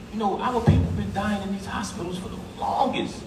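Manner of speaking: normal talking voice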